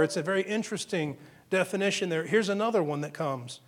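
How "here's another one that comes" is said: usual speaking voice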